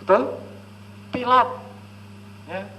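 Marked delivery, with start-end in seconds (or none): 0.0-1.5 kindergartner answering. Retold or imitated. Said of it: "anak-anak yang T K".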